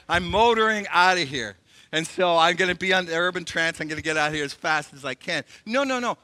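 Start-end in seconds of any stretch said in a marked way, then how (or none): none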